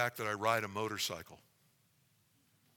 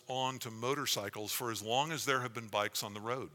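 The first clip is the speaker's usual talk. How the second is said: spooky ghost tour hush; 3 semitones higher, 10 dB louder than usual. Acted. Reads bell legend aloud dramatically.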